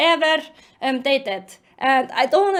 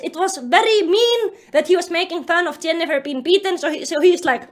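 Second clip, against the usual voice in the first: ordinary delivery, nothing marked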